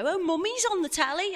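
put-on high, excited child's announcement; imitated little boy. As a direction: pipe up like a small son. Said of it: "when Douglas was little".

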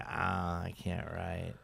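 low, soft imitation of giving up; lightly acted dejection, voice dropped right down; mock defeated writer. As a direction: sink into a quiet, hammed-up admission of defeat. Acting out Mike stuck writing.

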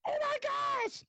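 high, shrill-pitched mock panic from the mom and daughter; imitating the mom and daughter freaking out. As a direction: squeal it like horse people losing it. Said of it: "They're like".

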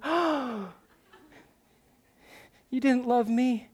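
high mock-wounded child voice; hurt little girl. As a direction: imitate a child shocked and hurt. Acting the daughter's shocked reply.

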